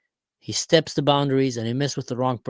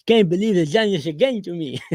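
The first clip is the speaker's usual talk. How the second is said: higher, louder cartoon sloth voice; Sid impression; 5 semitones higher, 4 dB louder than usual. Vlogger quoting Ice Age's Sid, high cartoon voice. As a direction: do a goofy cartoon sloth line. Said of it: "with Sid".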